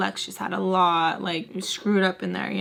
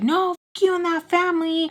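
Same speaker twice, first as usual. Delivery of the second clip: high-pitched acted-out cursing; retold angry retort; 10 semitones higher than usual. Fighting with the caller on the phone, high angry retort. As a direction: throw it back, pitched way up.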